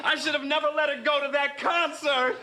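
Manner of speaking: high, loud panic over his daughter; panicked cry. Acted. Father panicking as his daughter gives birth, high alarm. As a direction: blurt it out in rising alarm.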